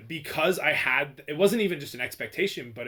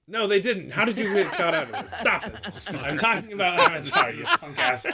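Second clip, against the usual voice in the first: amused, higher-pitched scolding; laughing protest; 4 semitones higher than usual. Real, not acted. Host corrects friend who mixed up Avatar and Gatsby, laughing protest. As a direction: protest through real laughter.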